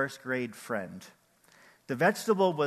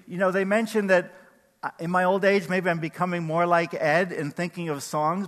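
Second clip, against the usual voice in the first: ordinary talk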